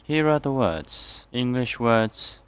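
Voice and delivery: ordinary talk, nothing marked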